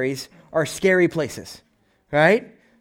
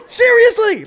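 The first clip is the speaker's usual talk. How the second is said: much higher, louder shocked seriously; squeaky disbelief; 8 dB louder, slower than usual. Retold or imitated. Family member squealing in disbelief at the pig story.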